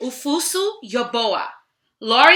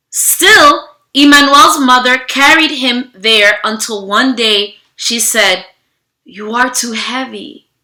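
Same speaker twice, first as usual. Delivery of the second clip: steady storybook narration, slowing on key moments; reading to children; 8 dB louder than usual. Acted. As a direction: read the story aloud to a class. Reads picture book to children.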